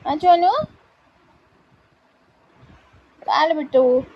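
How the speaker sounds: high, loud sing-song pet talk. Real, not acted. Owner comforting her crying puppy in Marathi, high cooing baby talk. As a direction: comfort the crying pup lovingly.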